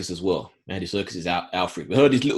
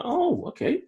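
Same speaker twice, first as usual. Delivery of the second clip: surprised. Retold or imitated. Surprised on first seeing Gordon.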